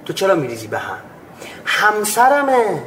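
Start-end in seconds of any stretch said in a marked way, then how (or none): 1.6-2.8 slow, drawn-out, high and indignant; indignant husband. Acted. Preacher voicing a husband who feels entitled, indignant. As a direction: stretch it out like he's been wronged. Acted husband defends his anger.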